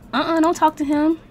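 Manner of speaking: ordinary talk, nothing marked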